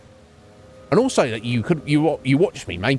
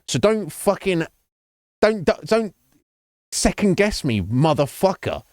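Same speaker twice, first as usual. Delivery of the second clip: rising, higher heated outburst at the customer; heated rant; 6 semitones higher, slower than usual. Real, not acted. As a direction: let the frustration climb, pitch going up. Ranting at second-guessing customer.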